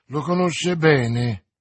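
loud, slow, furious outburst; angry exclamation in a flash of fury; burst of anger. A narrator's reading. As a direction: spit it out in a fit of rage. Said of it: "esclamò Milady in un impeto di collera".

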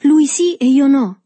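loud, high-pitched bold protest; protesta senza timidezza. A narrator's reading. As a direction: protest loudly, all shyness gone. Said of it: "perdendo ad un tratto la timidezza". (protesta forte e acuta)

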